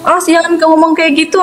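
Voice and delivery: high, mock-stern "awas ya"; mother warns the child, stern and high; warning. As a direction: threaten a naughty child. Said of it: "saya bilang gitu".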